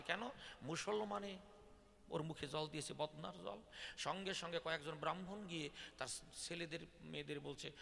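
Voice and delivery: ordinary talk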